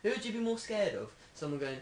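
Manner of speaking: ordinary delivery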